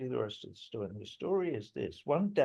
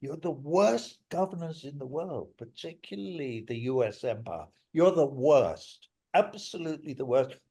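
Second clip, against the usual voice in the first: raised, heated tirade; angry rant; 5 semitones higher, 5 dB louder than usual. Real, not acted. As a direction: let the anger rise and push it out. Ranting at Western governments.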